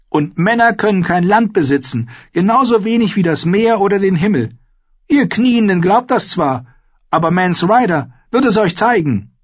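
put-on high, bold young wildling woman; spirited young woman, teasing; Ygritte voice. Narrator voicing Ygritte.

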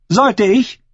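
high, challenging comeback; sharp retort. A narrator's reading. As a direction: throw the question back sharply. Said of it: "Angesichts der Schärfe in Aschers Stimme". (scharfe Gegenfrage in hoher Stimme)

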